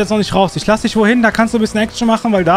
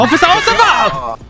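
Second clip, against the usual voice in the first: high, drawn-out cry; desperate shout; 5 semitones higher than usual. Acted. Driver calling to the officer, desperate high shout. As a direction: yell out in alarm, pitched high.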